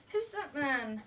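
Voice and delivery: put-on a boy's question pushed through clenched teeth. A narrator's reading. Boy asks the nanny about the guest, teeth clenched. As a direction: ask it with your teeth clenched. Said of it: "Will inquired through clenched teeth".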